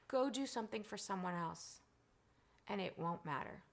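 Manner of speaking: soft, calm imitation of a father; hushed, measured fatherly counsel; quiet retold advice. Quoting her late father's calm advice.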